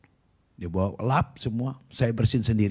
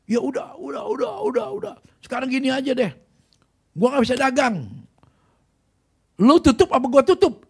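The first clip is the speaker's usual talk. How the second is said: high, strained voice, rising to loud; mock pained pleading; 10 semitones higher, 4 dB louder than usual. Troublemaker gives up and confronts the shop owner, high strained pleading. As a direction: imitate a rival giving in, squealed and exasperated. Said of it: "Dia teriak-teriak kesakitan".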